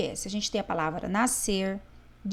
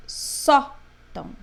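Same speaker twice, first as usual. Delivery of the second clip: Sótão with SÓ high and loud, then a faint TÃO; stress on SÓ; 4 semitones higher, 6 dB louder, much slower than usual. Shown as a demonstration. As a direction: punch the first syllable of Sótão, slowly. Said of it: "pra ele ficar mais forte". (Sótão com o SÓ bem forte)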